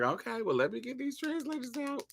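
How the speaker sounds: backing down quietly, a little embarrassed; meek high mutter. Retold or imitated. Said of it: "we was like".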